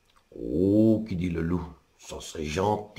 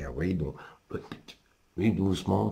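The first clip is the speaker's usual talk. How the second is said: usual speaking voice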